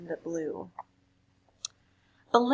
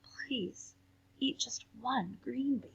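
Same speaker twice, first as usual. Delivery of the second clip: softer mild pleading; softer mother coaxing; 3 semitones higher, 7 dB quieter, slower than usual. Acted. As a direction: ask gently, softer. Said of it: "her mother said".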